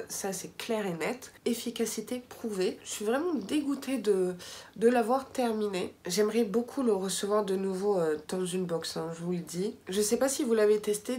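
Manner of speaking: ordinary talk, nothing marked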